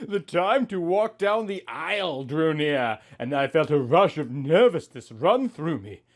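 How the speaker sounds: ordinary talk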